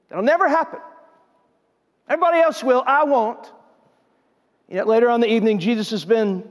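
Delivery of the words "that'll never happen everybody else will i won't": higher-pitched, insistent vow as Peter; adamant promise. Acted. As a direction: protest that you would never let him down. Peter vows he won't fail.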